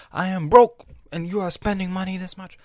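loud, high mock-angry dad; scolding dad. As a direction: bark the scolding like a furious father.

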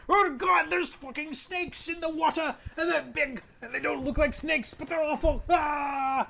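shrieking in terror, very high and loud; panicked scream. Acted. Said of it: "Lord Grace takes me, like, right".

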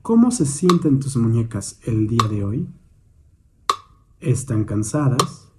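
calm, measured meditation guidance with long pauses; guided meditation. Real, not acted. Instructor guiding a listener's attention to the hands, meditative. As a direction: guide gently, leaving space after each prompt.

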